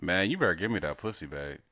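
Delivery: low, soft seductive murmur; bedroom talk. Pretend. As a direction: talk like you're seducing your wife. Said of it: "act like you were talking ... bedroom talk".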